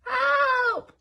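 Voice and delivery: high, loud shout; cry for help. Acted. Wilbur faking Elmer crying for help. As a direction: scream for help. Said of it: "came Elmer's voice".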